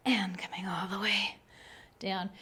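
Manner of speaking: soft whisper, fading out; whispered cue. Real, not acted. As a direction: let the words trail into a whisper. Quiet cue lowering down.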